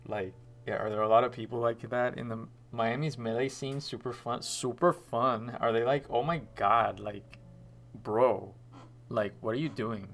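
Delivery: put-on loud Miami bro accent, lots of like and bro; exaggerated Miami accent. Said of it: "why did you redeem the Miami accent".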